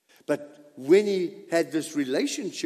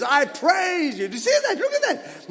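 much higher, laughing delight; joyful laughing; 14 semitones higher, 4 dB louder than usual. Real, not acted. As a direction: giggle with joy at the sight.

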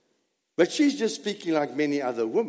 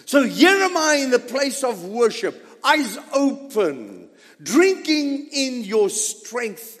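higher, louder psalm proclamation; fervent preaching; 7 semitones higher, 4 dB louder than usual. Real, not acted. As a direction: proclaim it with fervor.

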